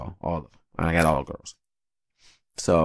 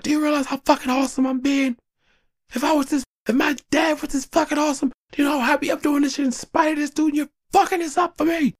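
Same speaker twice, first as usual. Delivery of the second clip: high frustrated rant. Retold or imitated.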